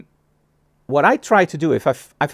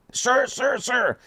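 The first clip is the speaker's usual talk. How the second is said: high-pitched mock calling out; imitating an excited shopkeeper calling out; calling after someone; 9 semitones higher, much slower than usual. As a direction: call him back, pitched up high. Shop owner running out calling.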